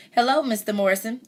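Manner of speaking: put-on high-pitched child's greeting, called out; mild narrated shout. A narrator's reading. Cassie greets Mister Morrison by the wagon, mild shout. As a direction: call a hello up in pitch, lightly shouted. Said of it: "I shouted as Jack pulled the wagon".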